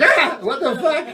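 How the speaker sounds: high mock yelp of fright, with a laugh; startled yelp. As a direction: jump as if a ghost just spooked you. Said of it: "You're like".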